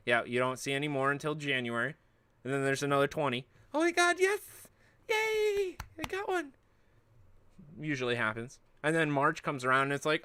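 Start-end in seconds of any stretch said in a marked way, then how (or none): 3.7-6.5 much higher giddy squeal; mock excitement. Streamer mocks second-wave buyer, high squealing joy. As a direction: shriek with fake joy. Mocking lucky card buyer.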